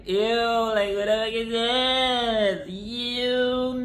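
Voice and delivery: put-on high, loud grossed-out teen whine; disgusted kid. A daughter asks her mom why she married this dad, grossed out.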